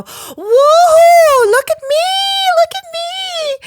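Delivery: very high, drawn-out hoot; slow falsetto call, hammed up; old photographer calling out. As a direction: imitate a photographer hooting for attention. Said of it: "he'd go".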